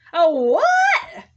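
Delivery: loud outraged oh what; a loud indignant exclamation; indignant disbelief. Real, not acted. Outraged at being labeled.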